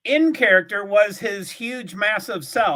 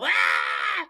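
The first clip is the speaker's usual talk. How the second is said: a deep mock "Ah!" in a low voice; low startled grunt. Shown as a demonstration. Redoing the startled cry, low grunted "Ah!". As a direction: drop way down for a low mock gasp. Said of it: "the right voice inflection to go".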